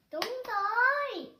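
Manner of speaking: high, loud, drawn-out đúng rồi; excited praise. Real, not acted. Teacher praising kids for the right answer, excited. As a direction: cheer them on warmly.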